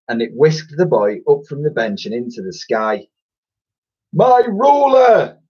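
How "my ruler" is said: very high, loud, slow bellow; furious headmaster's bellow. Acted. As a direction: roar after his lost ruler. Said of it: "bellowed Wrath".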